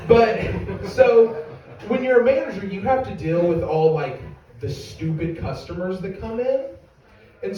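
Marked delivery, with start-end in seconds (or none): none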